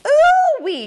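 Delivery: a high, loud cry of alarm; startled ooh-wee. Acted. Old woman nearly slipping on the creek stone cries out in alarm. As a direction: yelp as your foot slips. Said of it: "she said".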